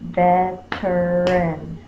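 veteran slowly in parts, "ve-ter-an"; clapped-out syllables. Shown as a demonstration. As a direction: break veteran into three even, separate syllables. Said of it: "Clap that one out".

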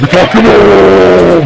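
real yell at the game; drawn-out shout. Real, not acted. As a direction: yell it out long and slow.

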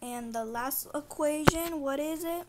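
normal talking voice

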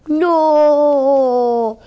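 loud, long mock cry; playful wail of no. Child acting out a planet mishap, loud mock wail. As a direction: draw out a pretend cry of dismay. Planet figure in distress at play.

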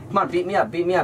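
high-pitched taunting dare; mock goading. Teen daring the bully to hit, goading. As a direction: dare them, pitched well up.

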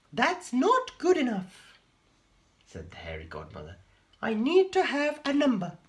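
very high, loud fairy voice; high magical godmother. A narrator's reading. The hairy godmother appears, very high and loud.

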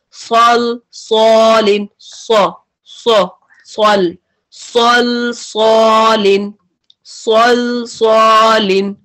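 सल and सॉलिन said thick, सॉलिन stretched slowly; heavy repeat-after-me drill. Shown as a demonstration. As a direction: model सल सॉलिन for students to copy. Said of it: "अब मेरे साथ pronounce करें".

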